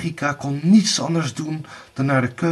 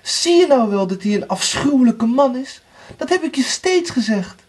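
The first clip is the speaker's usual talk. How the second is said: high, raised reproach; scolding sister; 11 semitones higher, 4 dB louder than usual. A narrator's reading. Anna reproaches Erika about her husband, high and indignant.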